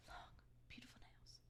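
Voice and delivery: barely voiced, mournful whisper; mock-devastated, very soft lament; retold distraught whisper. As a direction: whisper it like a heartbroken nail tech. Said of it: "like, distraught over".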